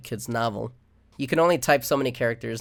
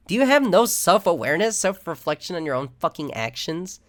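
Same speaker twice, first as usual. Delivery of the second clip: exasperated outburst; 5 semitones higher, 5 dB louder than usual. Real, not acted. Ranting, exasperated at scammers.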